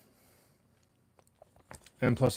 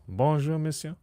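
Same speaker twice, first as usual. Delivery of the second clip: bonjour monsieur said higher and louder; mock French greeting; 6 semitones higher, 4 dB louder, slower than usual. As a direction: greet him in a hammy schoolboy French, louder. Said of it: "That's all I remember from school".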